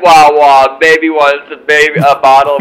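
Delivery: loud teasing mock baby talk. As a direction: tease like calling someone a baby. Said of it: "and you're just like".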